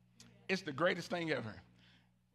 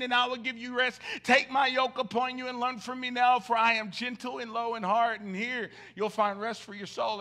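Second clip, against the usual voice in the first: louder, higher impassioned plea; full-force preaching; 6 semitones higher, 10 dB louder than usual. Acted. Pastor pleading with God before the church, loud preaching. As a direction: pour it out to God and the room.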